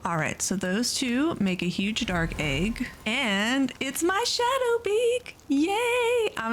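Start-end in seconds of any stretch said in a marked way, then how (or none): 3.1-6.3 much higher and drawn out on "Shadowbeak", then a squealing "Yay"; thrilled squeal. Real, not acted. Gamer to viewers, hatching her dream pal, delighted squeal. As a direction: gush over the egg you wanted most.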